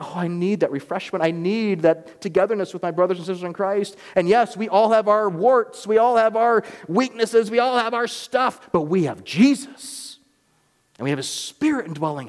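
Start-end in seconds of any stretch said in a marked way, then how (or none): 0.0-10.2 impassioned preaching. Acted. Pastor urging his congregation to hold fast, impassioned preaching. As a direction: lean in and urge them not to give up.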